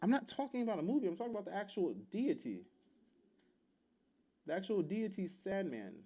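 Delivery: high-pitched, insistent clarification; exasperated correction. Real, not acted. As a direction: correct your partner, pitch jumping up. Correcting partner's misunderstanding about Sandman.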